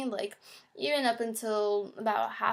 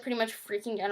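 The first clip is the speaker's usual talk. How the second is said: usual speaking voice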